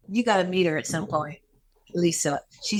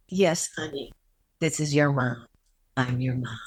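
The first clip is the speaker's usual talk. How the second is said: very calm; 6 semitones lower than usual. Retold or imitated. Mother answering her long-lost daughter on the phone, very calm. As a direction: soothe her gently, a mother at last. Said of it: "In a very calm voice".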